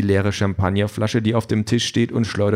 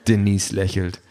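plain reading voice

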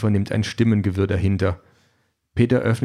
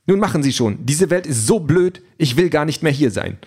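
higher, fed-up android voice; exasperated android; 6 semitones higher, 3 dB louder than usual. A narrator's reading. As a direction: give up in a huff. Calliope gives up, exasperated.

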